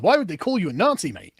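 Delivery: normal talking voice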